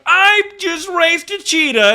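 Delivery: high, loud, drawn-out YouTuber hype; Mister Beast. Retold or imitated. Mimicking a YouTuber's video, in loud exaggerated hype. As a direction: shout it high like an over-hyped video opener. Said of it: "where Mister Beast has a video".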